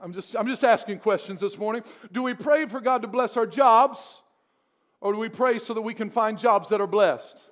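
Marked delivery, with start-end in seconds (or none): none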